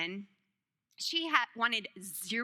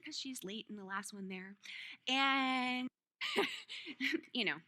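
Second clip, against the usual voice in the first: ordinary delivery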